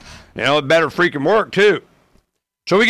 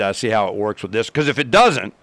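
ordinary delivery

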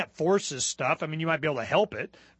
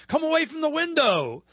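urgent warning; 7 semitones higher, 4 dB louder than usual. Acted. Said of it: "says a woman".

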